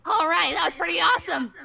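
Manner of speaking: normal talking voice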